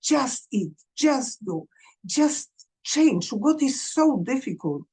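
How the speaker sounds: mock the impatient parent's thinking; exasperated parent thoughts. Parent venting at a child with an eating disorder, exasperated. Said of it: "we think".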